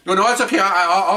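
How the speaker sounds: high, stammering retreat; mock backing down. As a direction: imitate a cowed diner giving in. Diner hastily backs down to waitress.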